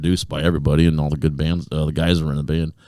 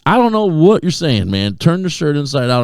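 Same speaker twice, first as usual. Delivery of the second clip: stern manager; 10 semitones higher, 5 dB louder than usual. Retold or imitated. Retold manager refusing explanation.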